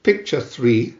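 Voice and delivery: usual speaking voice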